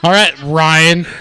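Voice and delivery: slow, loud drawn-out greeting; loud welcome. Real, not acted. Welcoming Ryan to the show, slow loud drawn-out greeting. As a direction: hype up the new guest.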